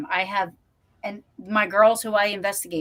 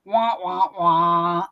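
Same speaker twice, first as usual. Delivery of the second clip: muffled wah wah noise; mock wah wah; 2 semitones lower, 3 dB louder, much slower than usual. Imitating the garbled sound she heard on the old EVP. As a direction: mimic a garbled recording sound. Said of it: "I was like".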